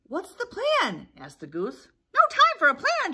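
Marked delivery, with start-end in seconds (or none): 0.0-1.1 high, slow, loud goose query; drawn-out high question from a goose. Acted. Goose asks the fleeing birds, high goose voice. 2.1-3.1 high, loud, urgent cry; puffing panic. Acted. Fleeing birds answer the goose, puffing alarm. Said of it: "they puffed".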